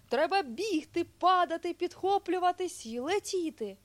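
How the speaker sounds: high, loud, eager bear; excited shout. A narrator's reading. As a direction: shout out the game with gusto. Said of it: "крикнув ведмедик".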